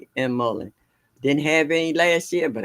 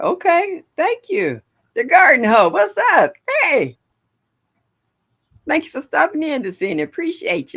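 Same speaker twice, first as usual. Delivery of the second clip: higher, louder, bright welcome to viewers; excited greeting; 13 semitones higher, 6 dB louder than usual. Real, not acted. Vlogger greeting viewers arriving in her stream, excited and high. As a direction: greet the chat with a burst of energy.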